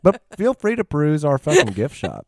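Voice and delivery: hint of a host greeting customers; lightly voiced restaurant host. Host telling waiting diners about the table delay, mock-polite.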